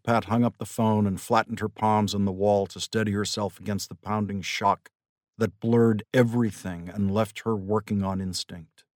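usual speaking voice

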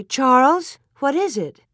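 high, urgent startled question; a sudden high, anxious query; alarmed question. A narrator's reading. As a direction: call out sharply, startled in the night. Wife startled awake by a thud at night.

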